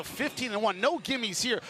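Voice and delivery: ordinary delivery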